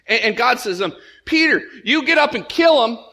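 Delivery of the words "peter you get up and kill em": high, loud order as God; commanding God. Retold or imitated. God to Peter in the vision, a loud command. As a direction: issue a forceful divine command.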